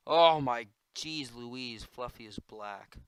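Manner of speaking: usual speaking voice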